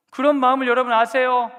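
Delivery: the question urged again; pleading call. Shown as a demonstration. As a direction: press 그런 hard to the crowd. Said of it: "repeated louder".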